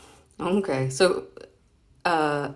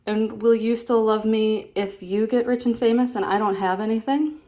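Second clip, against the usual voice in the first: higher, louder character read; comic character's line; 6 semitones higher, 6 dB louder than usual. Acted. Reading a comic to her son, a higher, louder character question. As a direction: ask it back with a sly lilt.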